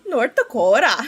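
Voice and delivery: imitating a North Dakotan; North Dakota accent. Streamer who loves her relatives' speech, North Dakota accent. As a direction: playfully put on North Dakota vowels. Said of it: "some of the best accents ever".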